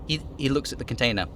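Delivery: usual speaking voice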